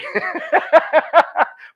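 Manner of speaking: high, loud laugh at his own joke; burst of laughter. Real, not acted. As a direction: crack up at the fake title. Joking about stealing show name.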